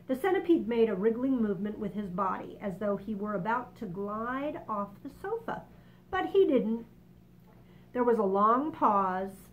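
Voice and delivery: plain reading voice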